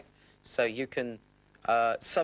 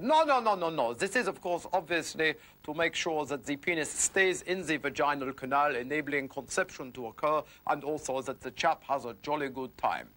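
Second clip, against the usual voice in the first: mock German accent, emphatic and higher at first; 4 semitones higher than usual. Germans correct the French survey result, mock German accent. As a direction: object like an insistent German official. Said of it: "The Germans did the same survey".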